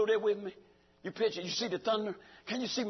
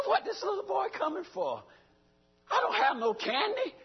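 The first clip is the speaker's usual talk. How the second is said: mock surprised high voice; 10 semitones higher than usual. Preacher voices himself to little boy.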